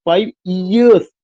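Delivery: "Five" light, then "years" pushed and stretched; stress on YEARS. Shown as a demonstration. Teacher demonstrating stressed syllable to students, "years" stressed. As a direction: put the weight on "years", not "five". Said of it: "ये जो जोर देना पड़ रहा".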